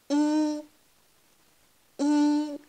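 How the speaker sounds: « I » tenu haut et plat; « I » chanté sur une note haute, sans bouger; yi premier ton. Shown as a demonstration. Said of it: "Premier ton". (yi au premier ton, haut et stable)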